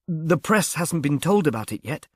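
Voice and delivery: usual speaking voice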